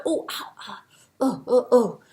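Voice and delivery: whispered mock ow of pain. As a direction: breathe out little hurt ows. Eland twists his foot.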